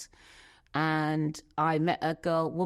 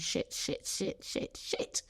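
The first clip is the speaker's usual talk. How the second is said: breathless unvoiced whisper of shit, shit, shit; whispered panic; 3 semitones higher, 8 dB quieter, slower than usual. Panicked whisper to herself while hiding her secret. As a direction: whisper the curses to yourself in alarm.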